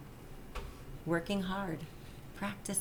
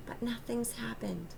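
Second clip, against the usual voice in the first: disappointed whisper; 4 semitones higher, 7 dB quieter than usual. A narrator's reading. Michael admits disappointment to Daddy.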